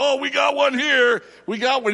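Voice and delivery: high, loud barker's call to the crowd; carnival barker shout. Retold or imitated. Game attendant announcing a winner, loud, high barker call. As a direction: bellow it like a midway game attendant. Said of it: "And he's going".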